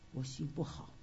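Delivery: soft, low, broken admission; almost swallow the words, low and soft; quiet confession. Retold or imitated. Confessing to sister Xiaoping.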